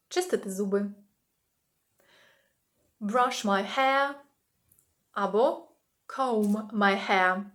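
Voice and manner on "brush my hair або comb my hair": slow model phrase. Shown as a demonstration. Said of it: "teacher models phrase".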